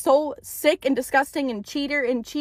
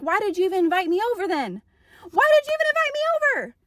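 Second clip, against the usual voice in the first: building exasperation; 6 semitones higher, much faster than usual. Retold or imitated. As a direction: climb from fed-up to an outraged, high-pitched demand. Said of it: "I'm like".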